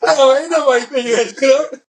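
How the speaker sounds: high voice breaking up with laughter; laughing. Real, not acted. Telling a friend about the chief's mess, laughing. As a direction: laugh your way through the retold retort. Laughs while retelling the quarrel.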